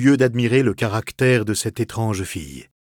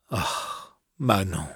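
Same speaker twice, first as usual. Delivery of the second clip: soft, breathy, tender sigh of her name; tender, much softer, with an unvoiced sigh; 4 semitones lower, 7 dB quieter, much slower than usual. The lover softly calls Manon in a tender tone. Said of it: "lui dis-je d'un ton tendre".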